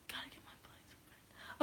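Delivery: whispered, slow, half-heard mumble; mumbled whisper. Retold or imitated. Retelling a Thanos line, slow whisper. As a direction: murmur it under your breath.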